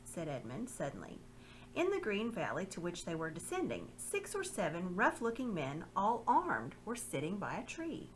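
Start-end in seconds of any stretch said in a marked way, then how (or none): none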